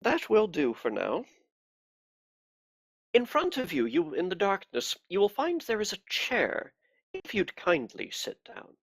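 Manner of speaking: a cold, pious interrogator; cold pious accusing. Acted. Inquisitor interrogates prisoner.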